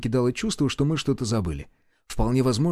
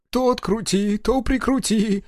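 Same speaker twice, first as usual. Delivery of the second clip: high, drawn-out grumble; put-upon mechanic's moan; disgruntled muttering; 9 semitones higher, slower than usual. A narrator's reading. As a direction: complain with a slow, high-pitched whine. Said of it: "недовольно пробормотал он".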